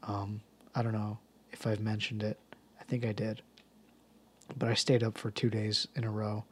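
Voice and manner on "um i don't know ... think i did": softer, drained murmur; tired. Real, not acted. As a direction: let the voice sag with tiredness. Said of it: "as you can probably tell".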